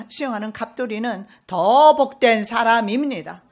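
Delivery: loud, driving sermon delivery; fervent loud preaching; full-force preaching. Acted. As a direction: preach it hard to the whole church.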